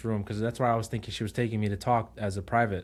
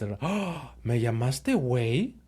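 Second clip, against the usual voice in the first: mock surprise; 2 semitones higher, much slower than usual. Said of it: "she goes".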